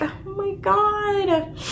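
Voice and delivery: high, slow, drawn-out gasp of disbelief; stunned oh my god. Real, not acted. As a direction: let it hang in heartbroken shock. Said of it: "And I'm like".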